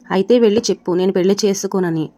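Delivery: plain reading voice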